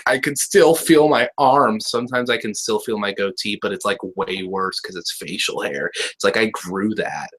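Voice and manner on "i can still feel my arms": lightly voiced war vet, slow and higher; a touch of a drawn-out veteran's lament. Mimicking war vets describing phantom limb, lightly voiced war vet. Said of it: "where war vets are like".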